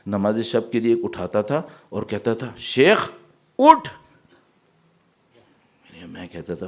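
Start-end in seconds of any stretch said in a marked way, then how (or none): 2.6-3.9 loud, high, abrupt order to get up; angry wake-up command. Retold or imitated. An angry heart rousing the sheikh for night prayer, angry shout. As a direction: order the sleeper up angrily, sharply raised voice. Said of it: "آپ بڑے غصے میں ہیں".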